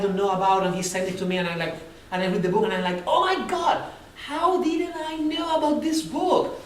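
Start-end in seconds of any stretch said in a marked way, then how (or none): 3.1-6.6 higher, slow astonished exclaim; mock amazement. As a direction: play at being amazed by a discovery. Imagined discovery of great book.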